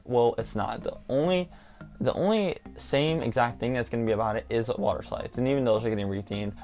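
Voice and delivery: normal talking voice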